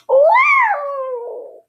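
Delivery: very high loud yowl; cat yowl. Pretend. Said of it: "sounded like a cat".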